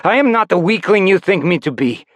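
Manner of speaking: high, loud defiance; defiant. Acted. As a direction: throw off the master's chains. Rebel defies former master.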